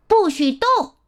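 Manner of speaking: high-pitched storybook order; short, high command from a boy king. A narrator's reading. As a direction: order the monsters to freeze. Boy orders the beasts to freeze.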